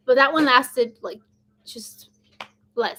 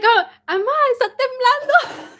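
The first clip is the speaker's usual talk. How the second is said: high, raised cry to mom; retold yell; 12 semitones higher, 4 dB louder than usual. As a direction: call out in alarm, half laughing. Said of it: "I yelled at my mom".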